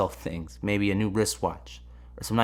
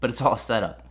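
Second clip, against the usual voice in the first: ordinary talk, nothing marked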